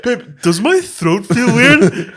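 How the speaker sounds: mock worried girlfriend. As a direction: drag it out, pretending to panic. Pretend voice changed from testosterone.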